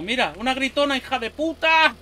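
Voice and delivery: yelling insults at a screaming zombie; loud call-out. Real, not acted. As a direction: shout it at the monster.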